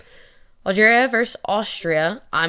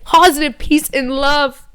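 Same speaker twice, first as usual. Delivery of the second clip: slogan; 7 semitones higher, 5 dB louder, slower than usual. Shown as a demonstration. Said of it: "we don't think negatively".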